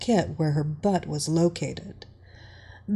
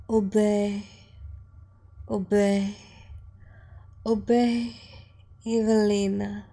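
put-on little girl voice. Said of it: "the submissive little girl repeated".

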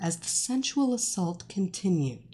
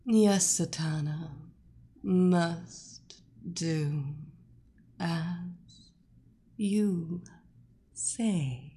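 dazed hypnotized voice; 3 semitones lower, 4 dB quieter, much slower than usual. Acted. Hypnotized captive answering her mistress.

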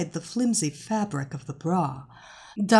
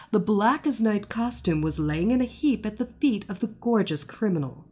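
ordinary delivery, nothing marked

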